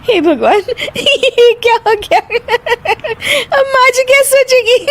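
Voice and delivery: high, dismayed worry through giggles; giggly, high-pitched self-reproach; uncontrollable giggling. Acted. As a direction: fret while unable to stop laughing. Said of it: "फिर से हंस रही है".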